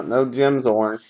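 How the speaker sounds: ordinary talk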